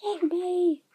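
high, hammed-up cry for rescue; playful panicked plea; mock panic. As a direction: plead to be saved, pitched high. Cries for rescue in game.

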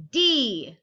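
sudden loud letter call. Acted. Calling out letters in game.

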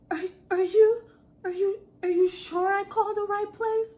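high, loud, stuttering disbelief; shaky, terrified caller. Retold or imitated. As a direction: stammer up high as a frightened customer. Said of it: "her voice sounded so shaky".

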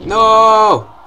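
long, high, loud wail; anguished gamer shout. Real, not acted. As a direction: cry out as you lose the round. Fails in the game.

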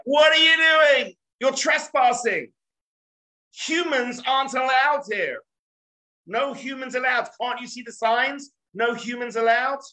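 bar the way like offended heavenly guards; indignant angels. Acted. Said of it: "the angels are like".

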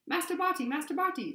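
high, loud elf wail; sobbing elf. A narrator's reading. Winky cries out to Master Barty through her hands, sobbing. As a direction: wail it as a distraught little elf. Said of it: "sobbed Winky through her hands".

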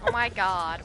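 normal talking voice

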